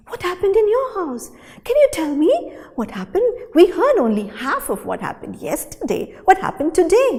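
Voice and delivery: high, eager gossiping voices; excited whispered gossip; gossipy chatter. Acted. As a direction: lean in, hungry for the news. Flames gossiping together.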